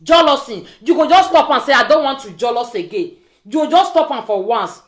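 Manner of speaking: ordinary delivery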